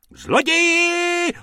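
very high, drawn-out scream; shrieking. Acted. Tobacconist lady sees Bobek take the hat, shrieking. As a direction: scream thief at the top of the voice. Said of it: "rozječela se paní z protější trafiky".

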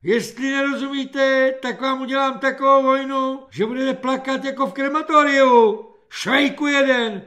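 high, loud corporal's tirade; ranting threat. A narrator's reading. Corporal threatening a new recruit, furious high shouting. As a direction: rant at the recruit in a sustained high yell.

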